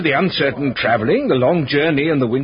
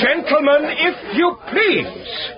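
calling for order; 3 dB louder, much slower than usual. Acted. Old clerk calling quarrel to order.

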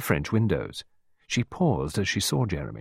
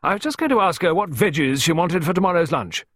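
high, loud, jolly voice; hearty countrywoman; 9 semitones higher, 5 dB louder than usual. A narrator's reading. Gardener asking about lunch vegetables, hearty. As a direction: carry it out across the garden. Hearty visitor continues booming.

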